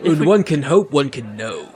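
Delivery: normal talking voice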